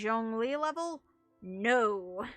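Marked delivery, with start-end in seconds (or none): none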